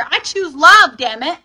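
loud, high, forceful affirmation; angry. Shown as a demonstration. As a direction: belt it out like you're angry. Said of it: "say it like you're angry".

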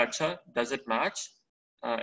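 ordinary talk, nothing marked

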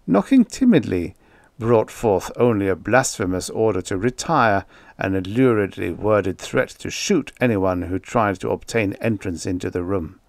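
ordinary delivery, nothing marked

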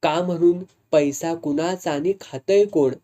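plain reading voice

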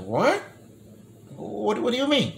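high-pitched, baffled questioning; retold disbelief. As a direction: act out being thrown, pitch jumping up. Retold disbelief at her revelation.

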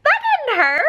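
pause, then very high realization; high-pitched mock relief; imitating surprised child. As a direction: squeak it like a pleasantly surprised little boy. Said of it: "Just like that".

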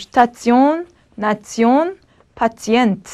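슈타치온, 나치온, 파치엔트 with "ts" before the I. Shown as a demonstration. Teacher models the endings for students, ts sound. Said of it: "이렇게 끝날 경우는 츠".

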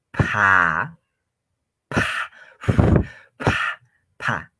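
"pa" with a strong breathy puff; aspirated P. Shown as a demonstration. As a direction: blow a burst of air through the P of "pa". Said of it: "Aspirado, aspirado". (pa aspirada, con soplo fuerte)

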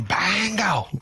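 high gleeful shout of bingo; mock yell. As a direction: call it out like a winning card. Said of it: "by the end, I was yelling".

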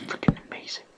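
breathy excited whisper. Real, not acted. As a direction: hush it out, amazed. Whispering over a find.

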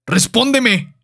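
heated confrontation; angry demand. Acted. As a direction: demand an answer sharply. Son demands an answer from father.

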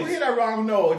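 laughing quote of herself. Retold or imitated. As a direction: repeat it through laughter. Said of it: "and I said".

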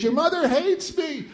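high, slow, tearful wail; wife weeping in the bedroom about the mother-in-law. Retold or imitated. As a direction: sob it out as a hurt partner. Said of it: "She says".

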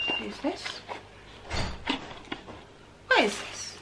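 normal talking voice

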